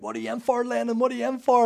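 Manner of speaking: high-pitched mock shout of old prison mates; calling out, imitated.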